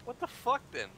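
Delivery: high, loud shocked outburst; disbelieving. Real, not acted. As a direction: blurt out in disbelief. Disbelief at enemy.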